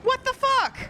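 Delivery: high, loud stunned cry; real disbelief bursting out; shocked outburst. Real, not acted. Sudden game glitch.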